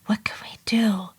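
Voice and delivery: urgent whisper. Acted. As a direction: whisper it in fear. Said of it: "I whispered".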